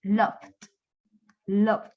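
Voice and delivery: low one-syllable "Loved", V into D; silent E ending. Shown as a demonstration. Teacher models the silent E, ending straight on D. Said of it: "la E casi nunca suena".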